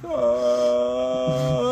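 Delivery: long, low mock-dismayed "uhh"; dumbstruck drawn-out groan. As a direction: let out a low, helpless drone. Own dumbstruck reaction to scolding.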